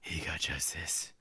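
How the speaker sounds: hushed whisper. Pretend. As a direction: breathe it out secretly. Dramatic aside after the death.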